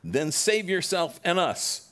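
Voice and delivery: high, loud, slow jeering voice; mock him from the next cross; blaspheming taunt. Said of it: "who was hanged blasphemed him".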